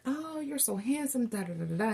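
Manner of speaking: high-pitched swooning pick-me; fawning admirer. Acted. Maidens falling all over Shrek, mock swooning.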